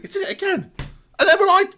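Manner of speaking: very high cry of mock despair; high squeal. Real, not acted. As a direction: squeal it out in a shriek. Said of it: "sudden outburst".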